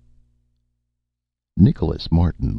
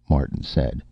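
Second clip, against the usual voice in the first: ordinary delivery, nothing marked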